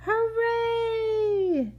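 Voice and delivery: high cheering hooray; crowd cheer. A narrator's reading. Narrator voicing the town crowd cheering, high. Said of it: "shouted all the people".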